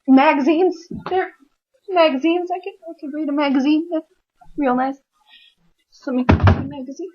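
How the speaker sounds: delighted nervous patient; excited about magazines. Retold or imitated. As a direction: perk up at the magazines. Relieved spotting magazines.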